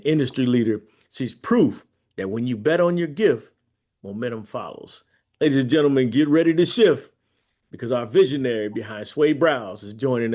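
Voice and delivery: loud, fast announcer read; radio host intro. Acted. Host welcoming the guest to the show, in a booming announcer voice.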